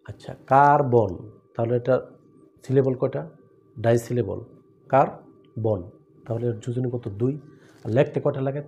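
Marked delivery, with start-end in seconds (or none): 0.5-1.1 carbon slow and loud, stretched as কা-র-বন; a slow, emphatic two-beat carbon; syllable by syllable. Shown as a demonstration. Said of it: "দুইবার দুইটা step নিতে হয়". 4.9-5.8 carbon broken in two with a clear pause, কার, বন; two separate steps, কার then বন; split into syllables. Shown as a demonstration. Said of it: "দুইবার দুইটা step নিতে হয়".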